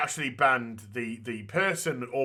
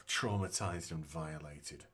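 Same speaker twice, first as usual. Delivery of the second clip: scoffing mutter; 10 semitones lower, 13 dB quieter than usual. Retold or imitated. Scoffing repeat of the claim.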